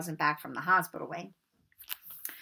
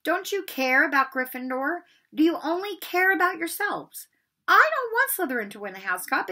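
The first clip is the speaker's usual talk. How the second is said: angry goose hiss; 6 semitones higher, 4 dB louder than usual. Acted. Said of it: "hissing at them like an angry goose".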